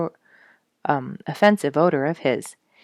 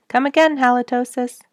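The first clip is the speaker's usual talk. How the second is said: calling after a departing skunk, higher and louder; called out; 8 semitones higher, 4 dB louder than usual. A narrator's reading. Companion calls after the skunk, raised calling voice. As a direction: call it out warmly into the dark. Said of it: "my companion called after him".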